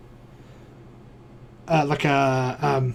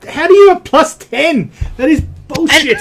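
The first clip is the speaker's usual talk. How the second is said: high, loud, half-laughing outrage; incredulous protest; 12 semitones higher, 6 dB louder than usual. Real, not acted. Game master disputes a player's plus ten, incredulous.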